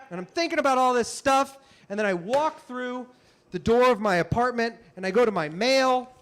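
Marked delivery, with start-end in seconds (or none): none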